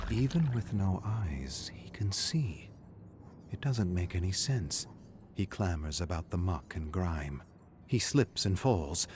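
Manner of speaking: usual speaking voice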